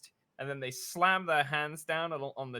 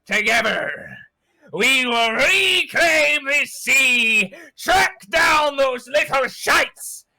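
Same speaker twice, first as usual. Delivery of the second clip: screaming, much higher and louder, slow; villain's roar; 11 semitones higher, 14 dB louder, much slower than usual. Acted. Captain commanding his dead crew to rise, screaming. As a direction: summon your creations with a mad roar.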